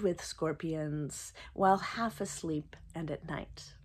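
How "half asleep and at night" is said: whispered. Real, not acted. Whispered end of a sentence.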